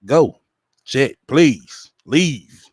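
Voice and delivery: ordinary delivery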